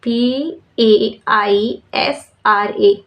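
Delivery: very slow, letter by letter; spelling aloud. Shown as a demonstration. Teacher spelling Paisra for students, slow and letter by letter. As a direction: spell each letter out slowly for the class. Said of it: "spells after naming Paisra".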